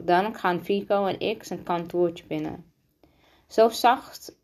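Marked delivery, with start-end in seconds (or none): none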